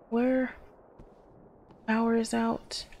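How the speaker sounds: normal talking voice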